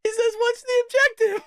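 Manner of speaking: very high laughing squeal; real incredulous laughing fit; crying with laughter. Real, not acted. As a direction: quote it while falling apart laughing. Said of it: "Oh, God, I'm crying".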